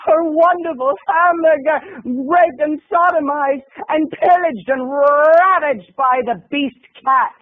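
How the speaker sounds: slow, high melodramatic mourning over a ruined burger; mock tragic lament. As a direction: wail over the burger like a tragedy.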